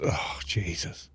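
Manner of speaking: whispered in disbelief; exasperated whisper. Real, not acted. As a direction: breathe it out, fed up. Exasperated at a bad video.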